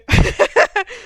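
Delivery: high, loud burst of laughter; short burst of real laughing. Real, not acted. Podcaster cracking up over the camping picture, high laugh. Laughs after saying okay.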